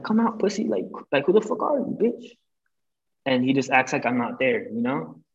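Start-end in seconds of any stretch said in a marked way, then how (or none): 0.0-2.4 higher, retold challenge to the driver, a touch of yelling; mock confrontation. Retelling calling out the driver of the car, higher taunting challenge. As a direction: call him out of the car, taunting.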